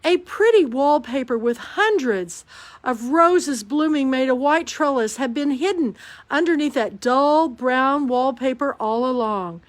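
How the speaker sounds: ordinary delivery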